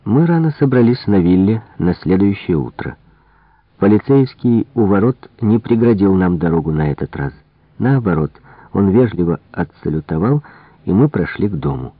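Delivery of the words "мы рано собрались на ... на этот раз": plain reading voice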